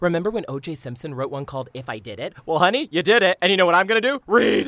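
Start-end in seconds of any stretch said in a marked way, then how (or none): 2.4-4.7 excited. Acted. Agent hyping memoir, loud.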